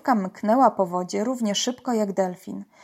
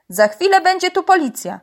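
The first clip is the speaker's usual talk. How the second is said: high, loud threat from afar; shouted warning; 9 semitones higher, 6 dB louder than usual. A narrator's reading. Uncle warns poachers police are coming, shouting. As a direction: call the warning out across the water. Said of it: "wołał z daleka wuj".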